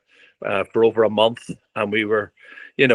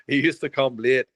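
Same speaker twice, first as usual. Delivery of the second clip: ordinary delivery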